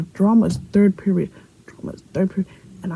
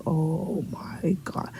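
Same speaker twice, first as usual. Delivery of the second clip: very slow, hushed disbelief; stunned reaction; 2 semitones lower, 10 dB quieter, much slower than usual. Retold or imitated. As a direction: imitate a principal taking in shocking news. Principal reacts to the news.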